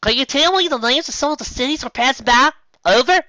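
high, loud radio call; calling over headset. Acted. Megan radios the sergeant, loud and high.

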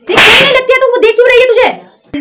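loud, high jealous accusation; sharp accusing yell; jealous outburst. Acted. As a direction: shout it at him in jealousy. Jealous girlfriend confronting boyfriend.

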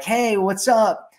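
lightly acted breezy hello from a text; casual texter. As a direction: imitate an easygoing message opener. Reading out a casual text message.

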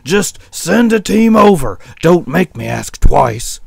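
loud, demanding sheep voice; a sick sheep's forceful plea; sick sheep crying back. Acted. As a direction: cry back loudly, insisting on help. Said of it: "The sick sheep cried back".

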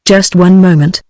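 ordinary delivery, nothing marked

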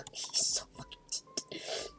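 very high, soft breathy squeak; whisper-squeal it, melting; squeaky whisper. Real, not acted. Cooing to her friend about the puppet in a tiny squeak. Gushing again.

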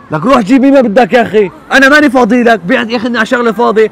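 loud, fed-up yelling at his father; angry shout. Acted. Son yelling at his old father to hurry up, angry shout. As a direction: bark it out, sick of him.

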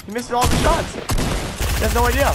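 high excited shriek; shrieking jackpot winner. Real, not acted. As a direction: squeal with disbelief. Watching a tense gunfight.

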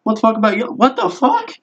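high, squeaky startled yelp of disbelief; startled shock. Retold or imitated. In the shower, suddenly noticing his tattoos, shocked and high. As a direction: jump in surprise at your own skin. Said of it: "I'm like".

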